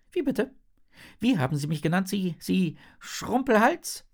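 high-pitched outraged fish voice; nagging, scolding fish. A narrator's reading. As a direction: scold in high, offended squeals. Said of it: "keift der Flötenfisch". (keifende, hohe Fischstimme)

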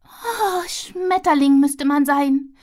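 slow dreamy sigh. Acted. Squirrel sighs dreamily after a butterfly. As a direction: sigh it wistfully. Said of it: "seufzte sie".

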